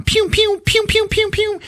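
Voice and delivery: high squeaky pew pew noises; laser pew sounds. Retold or imitated. Walker shooting the troopers, high squeaky pew sounds. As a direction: fire off toy laser sounds.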